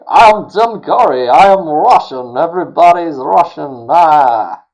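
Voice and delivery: loud, high, hammed-up Russian accent; mock a horrible Russian accent, booming and drawn out; Tim Curry, horrible Russian accent. Host mocking an actor's Russian role, horrible Russian accent. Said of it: "trying to do a Russian accent, and it's horrible".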